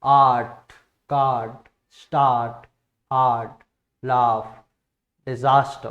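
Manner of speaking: long AA drawn out in आर्ट कार्ड स्टार्ट; long vowel demo. Shown as a demonstration. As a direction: stretch the long vowel in each word, clear and loud. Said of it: "ये आपके long vowels हैं".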